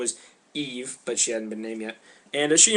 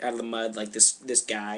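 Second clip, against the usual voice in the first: ordinary delivery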